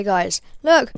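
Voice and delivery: ordinary talk, nothing marked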